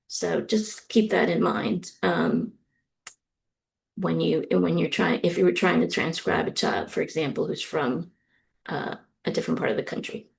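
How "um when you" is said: ordinary delivery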